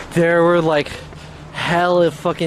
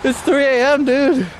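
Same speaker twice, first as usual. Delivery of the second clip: mock outraged yell; 12 semitones higher than usual. Telling friends how he reacted at 3 AM, mock outraged yell. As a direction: replay your own 3 AM disbelief as a shout. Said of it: "I was like".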